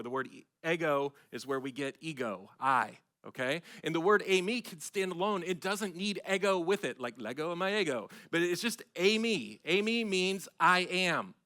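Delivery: loud, driving sermon voice; emphatic pulpit delivery, building and insistent; full-force preaching. Acted. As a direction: preach it out to the congregation.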